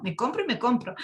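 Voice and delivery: joking through a laugh. Real, not acted. Preacher jokes to the congregation about buying shoes, laughing. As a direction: laugh at your own shopping spree.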